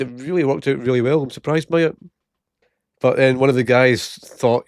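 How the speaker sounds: usual speaking voice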